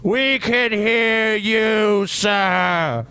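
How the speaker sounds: slow, loud yelling at a bad connection; yelling slowly. Real, not acted. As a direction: shout each word slowly so he hears. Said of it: "Yelling through the internet".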